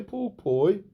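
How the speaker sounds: put-on "Pork Pie" in a high Brum accent with a rising tail; Brummie accent. Manager answers Pork Pie by name, "Pork Pie" in Brummie accent. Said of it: "What the hell is that voice?".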